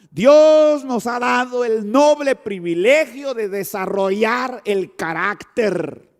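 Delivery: loud, slow emphatic preaching. Acted. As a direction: preach it out with full force. (predicación enfática y lenta)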